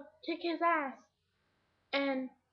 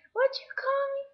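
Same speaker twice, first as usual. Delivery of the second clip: very high-pitched mock outrage; imitated indignant challenge; 11 semitones higher, 4 dB louder than usual. Insulted girl turning round to confront her, indignant. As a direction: challenge them in a raised, offended mock voice.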